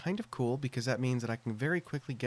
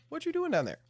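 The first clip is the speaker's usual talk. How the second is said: surprised; faster than usual. Real, not acted. Calling to a mob below.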